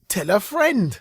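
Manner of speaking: ordinary delivery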